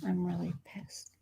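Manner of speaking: soft whispered annoyance; angry whisper. Real, not acted. Streamer whispers to viewers that she's annoyed. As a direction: hiss it under the breath.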